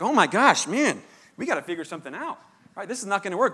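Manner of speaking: half-acted alarm at the small scholarship; alarmed exclamation. Preacher retelling his worry to the congregation, alarmed. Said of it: "I thought it was terrible news".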